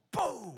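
loud, high booming sound effect; imitated door slam. Sister-in-law slams the car door, explosive boom. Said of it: "She slams the door".

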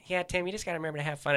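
fast, high, breezy advice; Bill on the phone. Retold or imitated. Bill Burr advising a nervous friend, fast. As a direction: toss off advice quickly. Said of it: "he's like".

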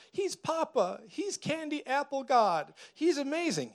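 high, sugary mock voice; mock sweet high voice; mocking sappy believer. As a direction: parody a gushing churchgoer. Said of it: "A lot of people are like".

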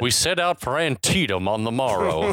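light Southern Civil War letter reading; a hint of an old Southern narrator; gently parodied Southern accent; mock Southern accent. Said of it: "it sounds like Shelby Foote".

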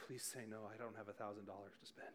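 quick, soft worried aside; inner thought. Retold or imitated. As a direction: mutter it fast under your breath, as if only thinking it. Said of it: "in my head, I'm like".

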